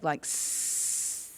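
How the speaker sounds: long unvoiced hissing s; snake hiss. Shown as a demonstration. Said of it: "make a sound like a snake".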